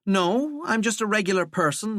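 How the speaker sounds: lightly voiced scornful young woman. Valkyrie answers Walter.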